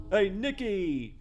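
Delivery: high, slow, stretched-out call in character; Ray Boccino's accent. Acted. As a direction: drag out the greeting in a put-on voice. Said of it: "what Ray sounds like".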